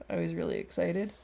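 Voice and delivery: ordinary talk, nothing marked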